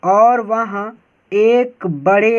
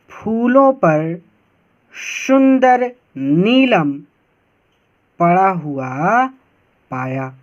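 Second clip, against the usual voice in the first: slow reading; slower than usual. Shown as a demonstration. Teacher reading translation slowly.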